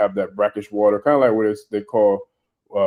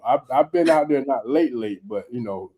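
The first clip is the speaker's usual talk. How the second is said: normal talking voice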